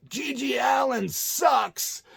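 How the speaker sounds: slow, high retold heckle; heckler yell. A crowd heckler yelling at the singer, slow and high. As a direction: bellow it from the back of the crowd. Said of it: "somebody else get".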